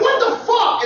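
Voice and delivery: high, startled what the fuck; shocked outburst. Retold or imitated. Owner shocked at his dog, high startled cry. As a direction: yelp it out in disbelief. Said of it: "I'm like".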